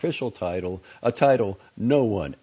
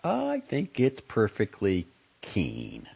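slow, tightly held reply; taut, higher-pitched mock approval; 6 semitones higher, slower than usual. A narrator's reading. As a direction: draw it out with strained restraint. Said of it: "said Whaling tautly".

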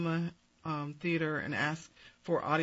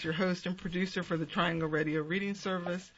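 ordinary talk

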